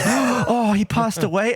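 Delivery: high, earnest mock disbelief; dead-serious shock. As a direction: retell it as sincere, stunned dismay. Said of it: "like, genuinely dead serious again".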